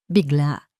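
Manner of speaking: ordinary delivery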